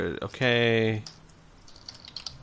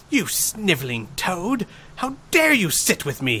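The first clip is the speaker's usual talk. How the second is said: high, furious bellow at a peasant; 7 semitones higher than usual. Acted. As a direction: roar it down at him. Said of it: "he bellows".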